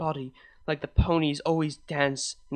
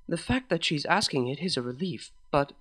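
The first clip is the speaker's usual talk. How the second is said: ordinary delivery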